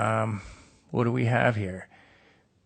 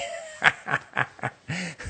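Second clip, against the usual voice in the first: real laugh; 4 semitones higher, 4 dB quieter than usual. Real, not acted. Laughs alone at himself.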